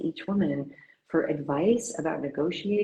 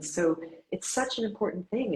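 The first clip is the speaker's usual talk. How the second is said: ordinary talk, nothing marked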